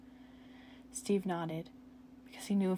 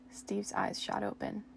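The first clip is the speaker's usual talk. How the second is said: ordinary delivery, nothing marked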